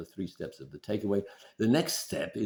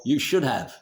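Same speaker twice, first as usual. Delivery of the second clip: higher, louder scolding nag; nagging critical parent; 5 semitones higher, 7 dB louder than usual. Retold or imitated. Wife nagging her partner like a critical parent, higher and louder. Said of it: "your critical parent".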